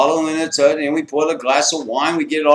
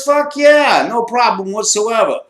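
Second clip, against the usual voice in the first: higher and louder, gleeful; retold friend cheering yes; eager yes; 9 semitones higher, 6 dB louder, slower than usual. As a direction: jump at the offer with delight. Friend eagerly agrees to drink.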